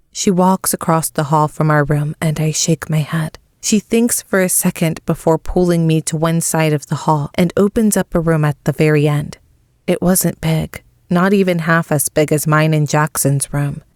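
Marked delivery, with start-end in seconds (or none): none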